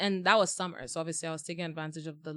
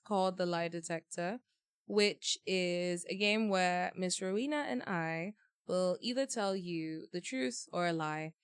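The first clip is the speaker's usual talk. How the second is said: ordinary delivery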